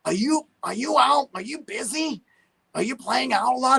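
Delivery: high odd imitated voice, asking eagerly; Danny's funny voice. Drummer Danny asking if the guitarist is busy, in a high imitated voice. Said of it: "this is how he sounded".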